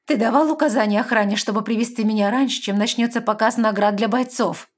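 high, loud pressing question; urgent demand. A narrator's reading. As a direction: press him for an answer. Lestra demands answer.

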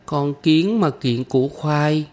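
put-on high, slow little girl's echo; small girl carefully reciting after her teacher; child repeating. A narrator's reading. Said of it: "Kim Chi nhắc lại".

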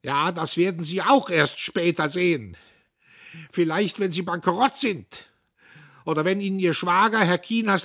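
very high, loud screeching threat; loud screeching old man; furious shriek. A narrator's reading. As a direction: shriek it in tearful rage. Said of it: "Er kreischte".

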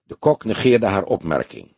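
ordinary delivery

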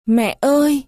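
narrated tearful plea; tearful call. A narrator's reading. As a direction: call out as if weeping, lightly acted. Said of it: "Em khóc gọi".

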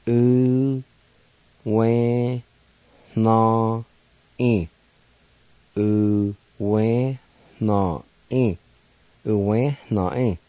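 U, we, na, i said slowly with the final N nasalized; careful Cherokee syllables. Shown as a demonstration. Teacher showing listeners the nasalized ending of U we na i. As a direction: sound out U we na i one syllable at a time. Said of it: "Let me pronounce it like this".

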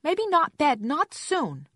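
put-on very high, loud child protest; annoyed toddler. A narrator's reading. Toddler in the tub objects to bedtime, annoyed child voice. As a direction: protest like a small girl refusing bedtime. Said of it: "Aissa was splashing in annoyance".

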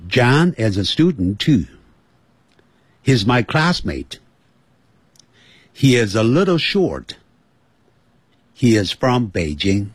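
English sentences at an even natural pace; normal speed. Shown as a demonstration. Teacher invites listeners to keep up, normal-speed English. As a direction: read it connected, no gaps between words. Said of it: "现在我用正常的速度".

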